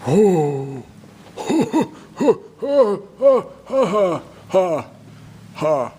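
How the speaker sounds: hammed-up staccato giant guffaw; Goliath's mocking laugh. Storyteller as Goliath scoffing at little David, big mocking laugh. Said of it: "Goliath sees David and he says".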